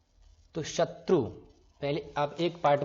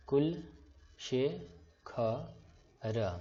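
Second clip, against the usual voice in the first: syllable by syllable; much slower than usual. Shown as a demonstration. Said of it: "syllables spelled one by one".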